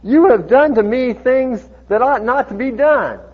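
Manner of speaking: loud, high accusation read out; dramatic, projected Bible verse; scripture reproach. Acted. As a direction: deliver the reproach as a grand pronouncement. Reads king's reproach from scripture.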